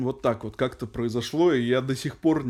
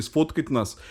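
ordinary delivery

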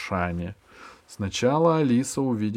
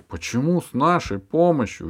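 surprised; 5 semitones higher, 7 dB louder than usual. A narrator's reading. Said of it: "удивилась Алиса".

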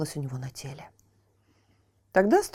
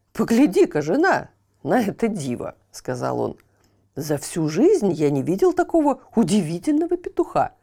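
louder, delighted watchman; amazed watchman; 4 dB louder than usual. Acted. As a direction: show off the marvel to your wife. Watchman shows wife the chick.

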